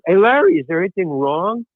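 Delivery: ordinary talk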